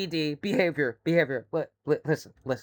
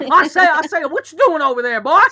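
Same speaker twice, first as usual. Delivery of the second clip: loud, high Southern chicken; high, blustering Southern accent; Foghorn Leghorn; 8 semitones higher, 9 dB louder than usual. Acted. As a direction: holler like a cartoon rooster. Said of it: "chicken with a high southern accent".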